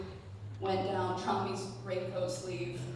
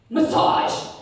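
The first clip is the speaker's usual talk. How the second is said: put-on loud, higher, whispery bark of a kid; kid's loud demand; 6 semitones higher, 10 dB louder than usual. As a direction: blurt it out like a demanding six-year-old. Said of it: "And he just goes".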